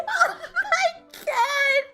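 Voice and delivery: high, slow, laughing oh my God; laughing disbelief. Real, not acted. Reliving the water in her face, laughing. Retold reaction while laughing.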